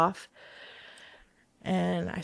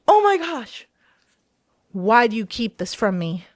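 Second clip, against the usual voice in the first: mock accusing kid; 3 semitones higher, 6 dB louder than usual. Son accusing mom playfully.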